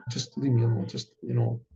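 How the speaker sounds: low, soft reluctant refusal; quiet brush-off. Retold or imitated. Father to the insistent boy, quietly declining. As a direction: back away from it under your breath.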